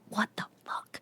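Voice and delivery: voiceless mouthed words, no sound; mouthed silently. Retold or imitated. Husband mouthing at wife on the phone, silent mouthed whisper. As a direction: mouth it across the room without voice. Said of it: "just mouths to me".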